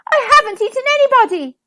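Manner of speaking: fast, high, loud wail; indignant, upset shout; howled protest. A narrator's reading. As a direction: howl your innocence. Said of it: "he howled".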